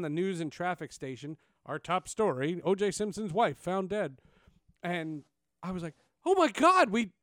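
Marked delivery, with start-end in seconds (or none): 6.3-7.1 high, loud gasp of shock. Retold or imitated. Intern hearing the news report, high and shocked. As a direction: blurt it out stunned.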